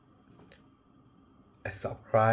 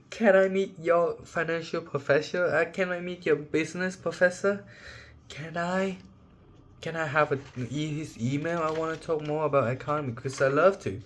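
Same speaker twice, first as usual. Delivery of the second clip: eager student; 6 semitones higher than usual. Retold or imitated. Acting out eager student asking.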